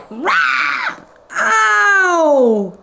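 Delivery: high loud yelp; vet's yelp of pain. Acted. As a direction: cry out as if bitten. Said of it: "said the vet".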